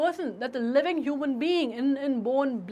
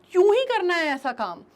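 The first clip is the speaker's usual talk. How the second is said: fed-up exclamation; 5 semitones higher, 6 dB louder than usual. Shown as a demonstration. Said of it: "ये basically एक exclamatory है".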